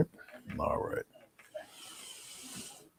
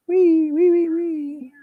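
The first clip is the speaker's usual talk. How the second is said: high squealing wee wee; pig squeal; 7 dB louder, much slower than usual. Retold or imitated. Painter repeating the pig's squeal while painting, high. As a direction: squeal it out like a piglet.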